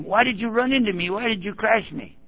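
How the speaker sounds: high-pitched accusing demand; upset accusation. Retold or imitated.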